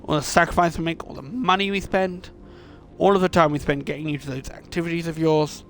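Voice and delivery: loud, high, mounting tirade; exasperated mother listing her sacrifices; outraged scolding. Acted. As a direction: pile up the grievances in acted anger. Furious mother lectures her child.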